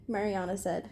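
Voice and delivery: ordinary delivery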